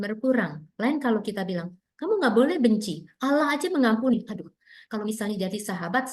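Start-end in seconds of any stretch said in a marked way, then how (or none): 1.9-4.3 higher-pitched, acted-out reprimand; mock scolding. Parent forbidding a child to hate, mock scolding. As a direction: imitate a parent telling a child off.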